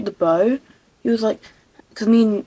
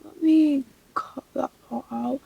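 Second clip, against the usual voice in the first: usual speaking voice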